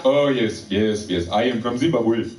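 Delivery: mock Zimbabwean accent, each yes set apart. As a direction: play an agreeable man with a Zimbabwean accent.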